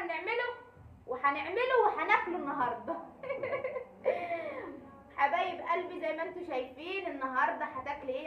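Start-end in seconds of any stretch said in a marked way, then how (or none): 3.0-5.1 bright burst of laughter; high laugh. Real, not acted. Big laugh after joke.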